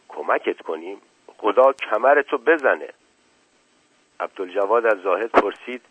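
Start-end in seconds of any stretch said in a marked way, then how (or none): none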